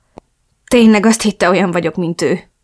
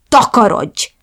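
yelled order; 4 semitones higher, 5 dB louder, slower than usual. Acted. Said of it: "de én ráordítottam".

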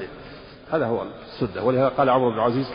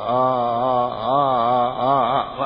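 vocal runs; 3 dB louder, much slower than usual. Shown as a demonstration. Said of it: "هذا التلحين".

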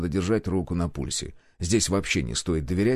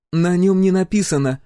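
louder, higher testy comeback; snapping retort; 9 semitones higher, 6 dB louder than usual. A narrator's reading. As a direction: snap back defiantly. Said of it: "огрызнулась Гюрза". (огрызаться с вызовом)

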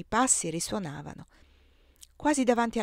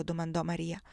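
ordinary delivery

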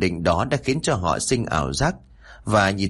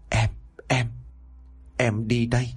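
understated sob, halting between words; mild sobbing; 4 dB quieter than usual. A narrator's reading. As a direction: voice a faltering goodbye through light sobs. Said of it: "nói trong tiếng nấc". (nghẹn ngào nấc nhẹ)

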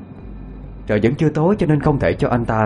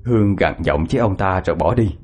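ordinary delivery

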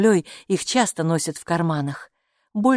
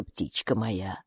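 tender; 10 semitones lower, 8 dB quieter, slower than usual. Acted. Gatekeeper fondly.

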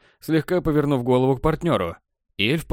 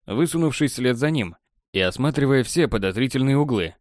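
ordinary delivery

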